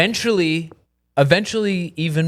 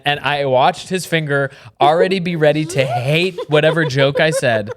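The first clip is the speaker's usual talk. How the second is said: usual speaking voice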